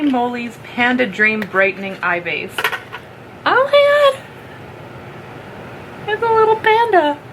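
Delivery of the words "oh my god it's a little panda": excited squeal. Real, not acted. Opening a friend's gift, she squeals at a panda. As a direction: gush like it's the cutest thing ever.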